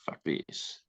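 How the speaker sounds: muttered dismissal. Retold or imitated. New actor giving up on the game, muttered.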